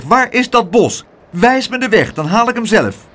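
high, loud order; loud demand. Acted. Aunt loudly demands Pluk tell her. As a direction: bark it at him. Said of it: "riep tante Pleeg".

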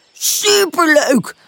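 drawn-out, high, delighted exclamation; barked enthusiasm. A narrator's reading. Puppy answering a friend about their game, excited bark. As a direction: bark it out slowly and happily. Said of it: "blaft Pip".

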